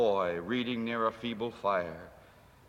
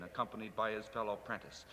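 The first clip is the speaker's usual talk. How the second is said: usual speaking voice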